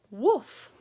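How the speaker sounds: cheeky spoken woof; mock woof. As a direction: bark it like a dog. Dog barks word.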